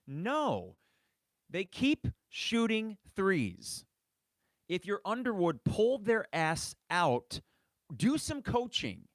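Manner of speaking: fed-up fan yelling at the coach to coach; frustrated rant. Real, not acted. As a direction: vent at the coach. Fan ranting at coach's decisions.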